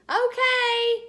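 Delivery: high, loud cheerful okay. A narrator's reading. Junie B answering her mother too loudly.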